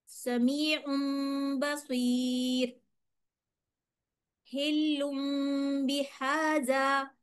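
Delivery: very slow, drawn-out recitation with nasal hums; slow chant-like reading with ghunna; slow Quranic recitation. Shown as a demonstration. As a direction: recite slowly, holding each m in the nose. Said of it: "नाक में भी लेके गई".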